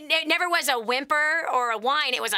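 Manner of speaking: normal talking voice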